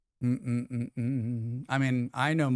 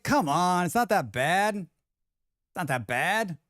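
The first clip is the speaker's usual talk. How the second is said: mock consoling; 4 semitones higher, slower than usual. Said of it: "I was like".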